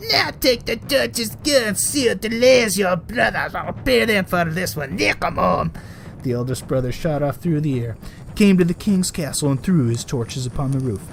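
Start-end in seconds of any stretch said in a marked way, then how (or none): 0.1-5.7 put-on old witch voice. A narrator's reading. Raging old woman sending eldest son out. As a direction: bark it like a raging crone.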